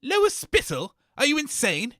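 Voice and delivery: ordinary talk, nothing marked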